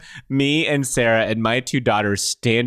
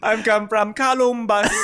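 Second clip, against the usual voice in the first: high, broken-English tourist voice; playful put-on foreign accent; mock foreign accent; 9 semitones higher than usual.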